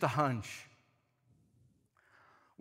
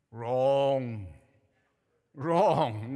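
low, drawn-out "wrong"; deflating verdict; 7 semitones lower than usual. Acted. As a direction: drop low and stretch it to burst the bubble. Bursts the imagined glee.

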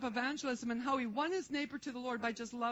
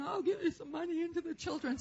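higher-pitched imitation of tearful pleading; mock weeping; 5 semitones higher than usual. Weeping customer offers money for the children, lightly mock-tearful. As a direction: voice a moved woman offering help, lightly imitated.

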